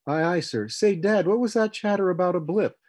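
high, loud, excited question; eager youngster. A narrator's reading. Son asking Dad about the radar blip, eager. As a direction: pipe up loudly and eagerly.

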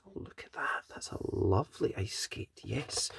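soft hushed admiring whisper. Acted. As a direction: whisper in quiet wonder.

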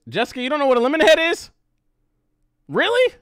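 fast, high, loud disbelief; real surprise. Real, not acted. Shocked someone doesn't know.

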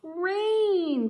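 high loud cry of alarm; ants crying out. Acted. Ants crying out as rain starts, high and loud. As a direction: cry it out in panic. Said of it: "cried the ants".

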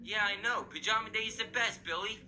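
normal talking voice

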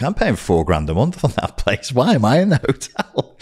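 rising, incredulous complaint; mild mock indignation. Him realising he pays rent yet sleeps elsewhere, indignant. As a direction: question it indignantly, pitch climbing at the end.

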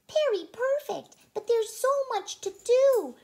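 high, bright-eyed little voice; Periwinkle's voice. A narrator's reading. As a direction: voice the small worried Periwinkle, high up. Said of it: "said Periwinkle".

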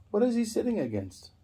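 normal talking voice

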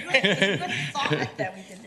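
words spilling through a laugh. Real, not acted. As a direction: laugh while you say it. Laughing at the joke.

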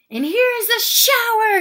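high, loud triumphant announcement; shouting. A narrator's reading. Man with a hose surprises the guest, shouting. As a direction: shout it out as a big reveal. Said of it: "he shouted".

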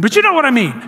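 ordinary delivery

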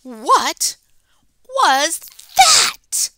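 high loud frightened cry; startled question. Acted. Kitten spooked by the mirror, high and loud.

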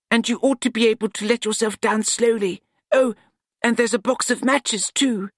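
eager to show his fix; young apprentice Nut. A narrator's reading.

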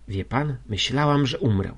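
usual speaking voice

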